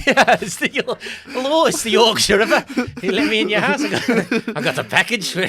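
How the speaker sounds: squeaky cartoon killer at the door; mock villain voice. Joining the joke as the Ripper, high, loud and laughing.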